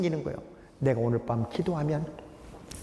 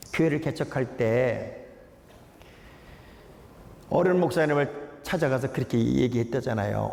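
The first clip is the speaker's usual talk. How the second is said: ordinary talk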